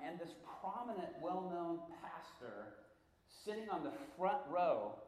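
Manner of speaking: ordinary talk, nothing marked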